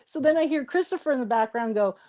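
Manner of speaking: normal talking voice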